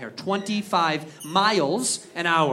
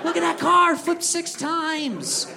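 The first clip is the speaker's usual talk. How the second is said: mock excited onlooker; 8 semitones higher than usual. Bystanders cheer spectacular crash.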